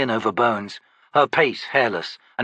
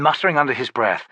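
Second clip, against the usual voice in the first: ordinary delivery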